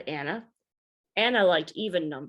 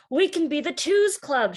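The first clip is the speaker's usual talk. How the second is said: put-on eager kid; 8 semitones higher, 6 dB louder than usual. Anna eagerly joins the group.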